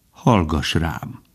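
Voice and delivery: slow, subdued counsel; grave old stag. A narrator's reading. Old stag asks Bambi to listen, grave and slow. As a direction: speak slowly and gravely.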